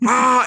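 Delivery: a high mock yelp as his jaw is ripped off; short, hammed-up high cry; mock scream of pain. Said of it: "he's like".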